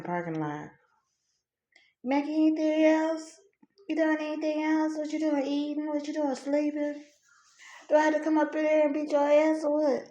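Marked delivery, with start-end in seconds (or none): 2.0-10.0 high, softer nagging voice; high-pitched parental scolding, kept soft. Retold or imitated. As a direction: fire off nagging questions in a high voice. Parent's nagging threat acted out.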